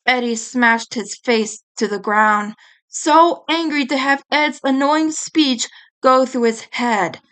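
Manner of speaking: plain reading voice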